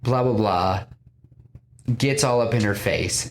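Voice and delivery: usual speaking voice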